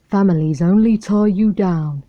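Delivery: lightly voiced rough stray dog; a hint of a gruff streetwise dog. A narrator's reading. The stray dog sizing up Lady, lightly rough. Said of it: "a rough voice".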